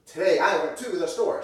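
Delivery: phrase that makes no sense; nonsense inflection. Shown as a demonstration. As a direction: punch the phrase in the wrong place. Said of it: "that doesn't make sense".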